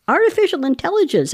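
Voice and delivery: well, obviously; obvious answer. Real, not acted. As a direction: say it like everyone knows.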